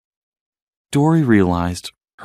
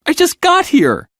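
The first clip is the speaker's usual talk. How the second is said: distressed; 3 dB louder than usual. A narrator's reading. Dory distressed.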